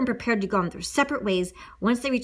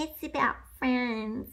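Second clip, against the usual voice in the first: ordinary delivery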